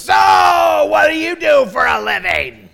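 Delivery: slow, high, hammed-up holler; really loud passenger. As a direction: impersonate an oblivious loudmouth seatmate. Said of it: "And it's really loud".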